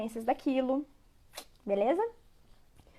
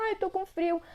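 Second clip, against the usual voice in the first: higher-pitched imitation of a child; child complaining; 6 semitones higher, 3 dB quieter than usual. Child out in the street feeling cold, imitated complaint. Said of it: "a hora que ela".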